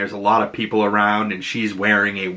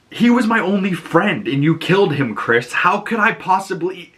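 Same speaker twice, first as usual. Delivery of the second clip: emotional; 7 semitones higher than usual. Retold or imitated. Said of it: "And Harcourt's like".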